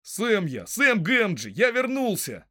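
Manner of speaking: high, eager shout of homecoming; calling out. Acted. Returning hobbit to wary neighbour, calling out his name. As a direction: announce yourself across the yard.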